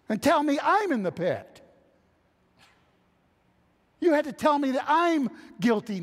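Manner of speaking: a mock-indignant listener protesting to Paul; mock indignant complaint. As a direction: play the offended listener griping at Paul.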